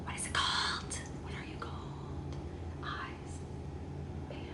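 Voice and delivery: breathless whisper to herself. Real, not acted. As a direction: whisper it while thinking hard. Whispers while searching for word.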